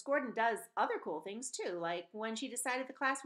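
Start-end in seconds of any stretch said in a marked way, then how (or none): none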